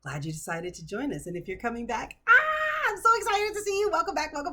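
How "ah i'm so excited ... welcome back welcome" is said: high, loud squeal of delight; squealing excitement. Real, not acted. Vlogger greeting returning viewers, squealing with delight. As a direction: burst with joy at returning viewers.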